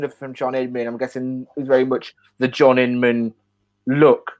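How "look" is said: normal talking voice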